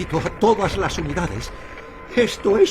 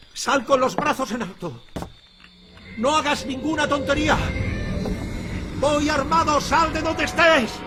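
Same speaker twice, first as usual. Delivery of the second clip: higher-pitched, strained challenge; tense warning; 5 semitones higher than usual. Acted. Sheriff challenging a hidden intruder, tense and alarmed. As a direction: call out a nervous armed warning.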